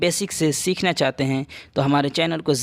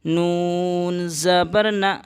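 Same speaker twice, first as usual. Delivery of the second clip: slow letter-by-letter spelling; teacher spells out نا slowly; 2 semitones higher, much slower than usual. Shown as a demonstration. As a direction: spell نون زبر نا slowly. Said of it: "تو اس طرح سے پڑھیں گے".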